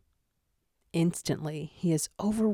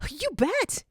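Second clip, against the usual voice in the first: high eager fanboy cry; excited exclaim; 13 semitones higher than usual. A narrator's reading. Rookie answering Zero, bursting with fanboy excitement. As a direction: burst out like a starstruck fan. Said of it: "Gadget exclaims, fanboying out".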